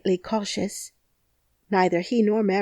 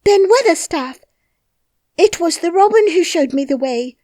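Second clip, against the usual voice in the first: loud, much higher breathless call; gasping call; 10 semitones higher, 8 dB louder than usual. Acted. Mary calls up to Ben, loud, high and breathless. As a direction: call up to him, breathless and urgent. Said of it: "called up to him with a sort of gasp".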